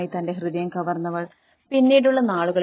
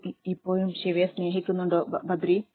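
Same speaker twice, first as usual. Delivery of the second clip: ordinary delivery, nothing marked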